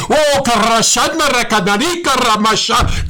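shouted ecstatic tongues; fervent shout in tongues; speaking in tongues. Real, not acted. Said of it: "speak in tongues the next moment".

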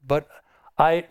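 normal talking voice

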